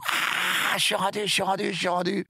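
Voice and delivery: high, exultant mock cry of arrival; retold cry of triumph. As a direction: relive the moment you reach shore, crying out up high. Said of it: "je pousse un grand cri". (cri de victoire rejoué, aigu et exalté)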